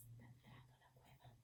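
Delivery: hushed fast whisper. Acted. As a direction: whisper it like a secret. Narrator drops to whisper.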